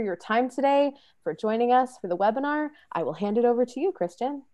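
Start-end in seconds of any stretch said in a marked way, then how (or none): none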